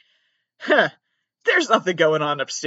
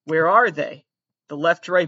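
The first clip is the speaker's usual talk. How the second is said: ordinary delivery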